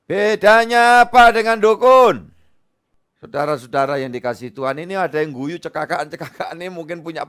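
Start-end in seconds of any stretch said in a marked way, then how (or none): 0.1-2.3 louder, higher, slower challenge; emphatic shout. Real, not acted. Pastor challenges congregation on fortune-telling, loud slow shout. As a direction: hammer the question at the crowd. Rhetorical question repeated louder.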